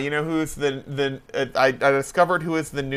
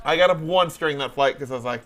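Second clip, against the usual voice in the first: usual speaking voice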